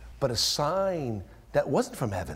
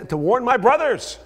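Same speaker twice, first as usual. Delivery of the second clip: higher, louder cry; desperate plea; 11 semitones higher, 9 dB louder than usual. Acted. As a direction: beg it from hell. Rich man pleading from hell.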